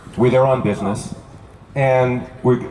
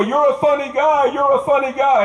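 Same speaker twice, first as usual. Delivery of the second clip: homeless guy amused; 11 semitones higher than usual. Retold or imitated. Homeless guy laughing at joke.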